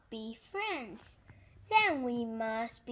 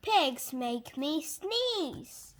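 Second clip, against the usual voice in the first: ordinary delivery, nothing marked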